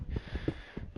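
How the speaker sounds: long, breathy mock sigh; exasperated sigh. Gamer replaying his reply to a late friend, exasperated sigh. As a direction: let out an exasperated sigh, retold.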